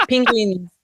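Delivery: a single word in a Kiwi accent; New Zealand accent. Shown as a demonstration. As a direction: clip it the Kiwi way. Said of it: "do a New Zealand accent for me".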